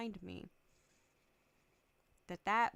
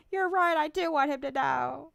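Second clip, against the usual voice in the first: higher and much louder, blurting out the truth; loud admission; 7 semitones higher, 11 dB louder than usual. Retold or imitated. Conceding to the therapist, a high, loud blurted admission. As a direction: give in and say it out loud.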